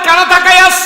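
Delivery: shouted, high demand; loud preacher shout; booming challenge. Retold or imitated. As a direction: roar the question at him. Preacher shouting the scholar's line.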